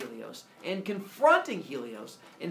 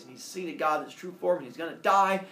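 ordinary delivery

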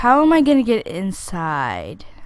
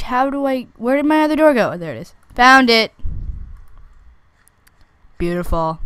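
normal talking voice

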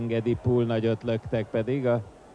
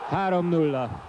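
excited score call; 6 semitones higher than usual. Real, not acted. Third goal score call.

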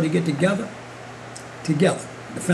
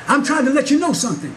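preaching at full force; 5 semitones higher than usual. Acted. Preacher retells the pastor's rebuke to his church, preaching. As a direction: lift it higher and higher to the congregation. Exhortation to the congregation.

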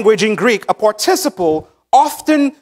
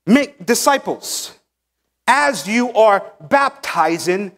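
slow, each phrase set apart, weight on make; deliberate preacher's reading of As you are going, make disciples; slow emphatic reading; slower than usual. Shown as a demonstration. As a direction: read it out slowly, leaning on make disciples each time. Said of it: "this is how... we should read this".

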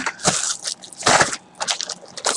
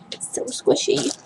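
whisper; 4 semitones higher, 10 dB quieter than usual. Real, not acted. Same words, whispered take.

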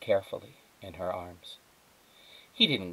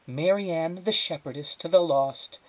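mother's voice; 8 semitones higher than usual. Acted. Mother voiced, grand naming.

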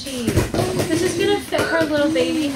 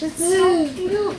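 ordinary talk, nothing marked

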